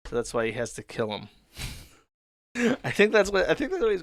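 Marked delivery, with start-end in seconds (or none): 2.6-4.0 real laugh spilling into higher-pitched words; amused laughter. Real, not acted. Host offering a wild interpretation, amused and laughing. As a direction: start with a laugh, voice lifted.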